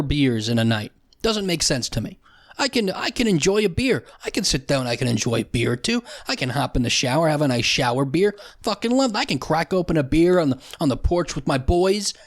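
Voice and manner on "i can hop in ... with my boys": usual speaking voice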